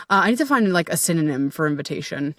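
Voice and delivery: ordinary talk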